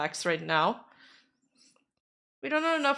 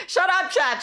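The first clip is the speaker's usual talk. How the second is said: playful shut up; 9 semitones higher, 4 dB louder than usual. Real, not acted. Teasing chat back.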